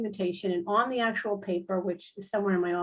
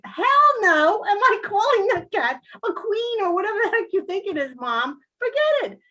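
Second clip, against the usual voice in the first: much higher, loud bratty refusal; defiant teen daughter; 10 semitones higher, 6 dB louder than usual. Retold or imitated. Daughter refusing to call the cat a queen, high and loud. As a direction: play the daughter flatly refusing. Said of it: "my daughter's like".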